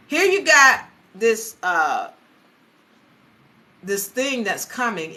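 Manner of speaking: normal talking voice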